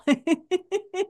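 a high burst of giggling; high giggle. Real, not acted. Laughing at her own subconscious impression, high giggle. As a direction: giggle at your own joke.

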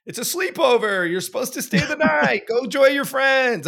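very high, loud singsong mother; mock mom voice. Mom urging her son to stay at the sleepover, high mock-chirpy. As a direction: imitate a mom cheerily waving away a plea. Mother brushing off his plea.

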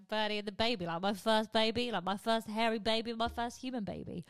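normal talking voice

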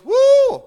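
high, very loud "woo"; sudden high attention-getting holler; loud whoop. Acted. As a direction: whoop out to grab a crowd's attention. Acting Jesus standing up crying out.

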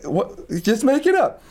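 high-pitched mock fluster; acted retold exchange. Player retelling their first D and D game, high mock fluster.

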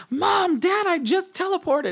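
very high, squealed news to parents; excited announcement. Retold or imitated. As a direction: announce it in a high, giddy pitch. Acts out excited text to family.

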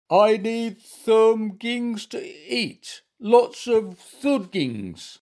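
high, very slow bear moan; pitiful pleading. A narrator's reading. Bear pretending illness begs for food, slow pitiful moan. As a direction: draw out each word, high and needy.

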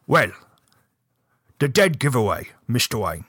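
halting, wandering attempt at British; badly faked British sleuth explaining clues; shaky British accent. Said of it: "British just wanders all over the place".

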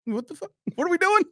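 high, incredulous squeak; disbelief. Retold or imitated. Host squeaks in disbelief at Wahlberg's pick. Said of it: "I was like".

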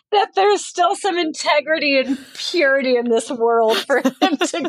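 talking through a laugh. Real, not acted. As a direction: laugh through the words, voice up high. Laughs at her own hope.

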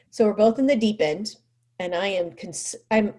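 ordinary talk, nothing marked

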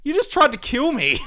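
high, loud disbelieving cry; incredulous outburst. Retold or imitated. Player yells at the secretary who attacked them, loud disbelief. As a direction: shout it in disbelief.

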